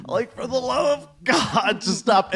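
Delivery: high exasperated plea; mock pleading, drawn out slowly; laughing frustration. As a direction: beg in comic frustration. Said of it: "equal parts of frustrated and just laughing".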